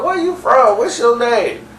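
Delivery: put-on high voice, firing questions; high-pitched questioning. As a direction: imitate a woman in a high voice. Voices a woman being chatted up.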